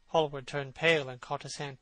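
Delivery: usual speaking voice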